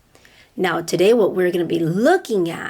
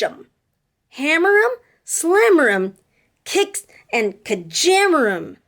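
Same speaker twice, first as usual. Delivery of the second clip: put-on reading to children; 6 semitones higher than usual. A narrator's reading. Teacher reading a picture book to her class, sing-song. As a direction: read it with rhythm for little listeners.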